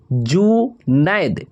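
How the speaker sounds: Junaid stretched slow, syllable by syllable; slow dictation of Junaid; drawn out slowly. Shown as a demonstration. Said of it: "और जुनैद का हो जाएगा".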